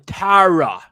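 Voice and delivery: overdone fake American, half laughing through it; Charlie Hunnam's American accent. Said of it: "his voice sounds so weird in that".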